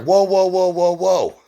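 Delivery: high, loud whoa to stop everyone; Gabby stepping in front of the drawn guns, urgent calming shout; urgent calming call. Retold or imitated. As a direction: shout them down, hands up, easy.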